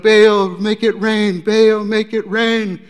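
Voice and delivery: loud, slow, desperate chant to Baal; frantic shouted plea. Retold or imitated. Worshippers begging Baal for rain, shouting slowly. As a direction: cry out to the idol for rain.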